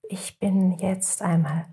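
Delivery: guided meditation. Acted. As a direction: guide the listeners into rest, unhurried.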